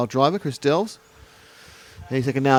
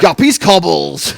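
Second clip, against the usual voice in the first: slow, loud and high, stretching Guppy's Cobbles out; excited call; 4 semitones higher, 6 dB louder, slower than usual. Real, not acted. Commentator excitedly calling the car over Guppy's Cobbles.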